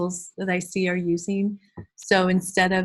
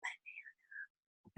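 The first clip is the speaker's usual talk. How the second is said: very soft, slow whisper; 27 dB quieter, much slower than usual. Shown as a demonstration. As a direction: whisper it slowly with barely any sound. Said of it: "with our level two voice".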